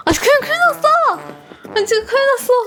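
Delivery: high-pitched alarmed cry; panicked exclaim. Real, not acted. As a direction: yelp it out in alarm. Panicked realisation.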